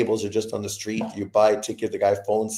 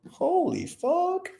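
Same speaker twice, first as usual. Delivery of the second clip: high, slow drawn-out amazement; stunned exclamation; 3 dB quieter, much slower than usual. Real, not acted. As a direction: stretch it out in awe. Imagining being stranded.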